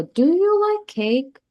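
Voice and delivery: "cake" ending a slow question with a high, rising lilt; drawn-out, high-pitched question rising toward "cake". Shown as a demonstration. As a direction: ask it with pitch climbing well up through "like cake". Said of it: "these we use for questioning".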